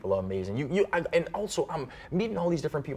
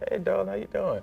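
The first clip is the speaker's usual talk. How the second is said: New Orleans greeting; 9 semitones higher, faster than usual. Shown as a demonstration. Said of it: "darling is my favorite thing to say".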